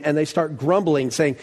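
ordinary talk

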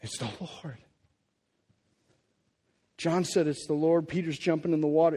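ordinary talk

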